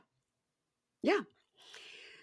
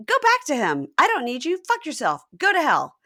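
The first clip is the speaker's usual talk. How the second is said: raised, loud mock fury; acted angry dismissal; 6 semitones higher, 6 dB louder than usual. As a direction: send her packing as the fed-up boyfriend. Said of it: "Gino says".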